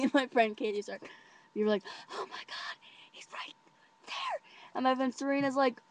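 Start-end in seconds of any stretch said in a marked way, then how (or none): 2.1-4.4 whispered, giddy alarm; excited whisper. Retold or imitated. Friends spot the boy nearby in line, excited whisper. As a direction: whisper it so he won't overhear.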